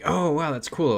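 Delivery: acted-out wowed small talk; retold impressed reaction. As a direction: imitate an eagerly impressed stranger.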